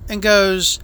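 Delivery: usual speaking voice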